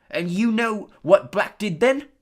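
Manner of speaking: ordinary delivery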